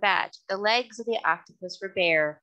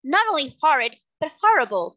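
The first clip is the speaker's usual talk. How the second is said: insisting, higher and louder; persistent; 7 semitones higher, 7 dB louder than usual. A narrator's reading. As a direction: press the insult home emphatically. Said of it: "persisted the girl".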